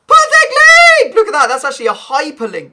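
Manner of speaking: yelling with delight; excited shout. Real, not acted. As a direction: shout it out, thrilled. Excited pack opening find.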